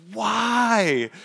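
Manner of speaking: a high, loud anguished yell. Retold or imitated. Yelling at God in his driveway, high anguished yell. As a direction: hurl the word up at the sky. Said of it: "just yelling in my driveway".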